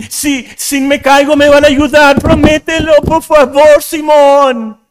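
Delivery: loud, high, pleading cries of a man who can't swim; frightened shouting. Retold or imitated. Disciple begging Simón for help in the storm, scared and loud. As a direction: beg for help, rising into a scared yell. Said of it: "Y los gritos estaban asustados".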